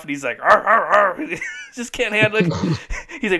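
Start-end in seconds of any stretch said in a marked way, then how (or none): 0.4-1.3 grunting arr arr arr, louder and higher; mock grunts. Husband fed up with shoe talk, mock grunting. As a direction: do Tim Allen's frustrated grunt. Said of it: "he goes off".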